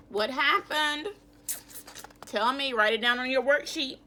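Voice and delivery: ordinary talk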